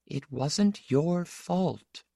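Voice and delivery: slow, high mother's voice; softly exultant, pitched well up; soft exultation. A narrator's reading. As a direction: absolve him slowly and softly. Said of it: "the soft exultation coming into her voice".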